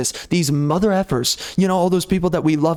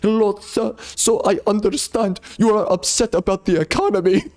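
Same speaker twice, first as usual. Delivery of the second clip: higher, lightly put-on Indian accent; mock Indian accent; 4 semitones higher than usual. Caller mocking a bank agent with a mild Indian accent. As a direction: play an Indian call-center agent, politely off-topic. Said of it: "They speak this broken English".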